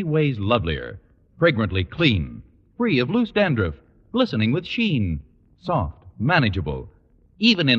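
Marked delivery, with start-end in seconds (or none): none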